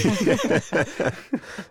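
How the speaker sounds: big high burst of laughter; loud laugh. Real, not acted. Reacts to wife joke.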